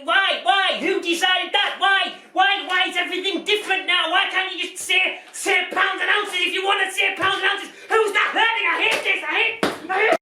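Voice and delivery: mock outburst. Presenter losing his temper over pounds and ounces, ranting. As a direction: lose it in a hammed-up rant. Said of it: "Apologies for that minor outburst there".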